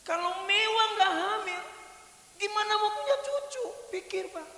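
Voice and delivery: cheeky lecture. Acted. Daughter lectures her father.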